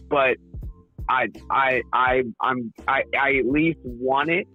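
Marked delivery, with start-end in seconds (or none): none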